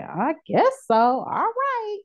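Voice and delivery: high, drawn-out grudging agreement, the all right climbing very high; a mock-hesitant shrug of a yes; reluctant yes. Finally agreeing to join Coke, high mock-reluctant all right.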